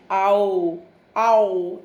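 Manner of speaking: आओ said slow and open, the mouth rounding wide; drawn-out ow sound. Shown as a demonstration. Teacher showing children the ow sound, mouth open wide. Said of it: "look at my mouth".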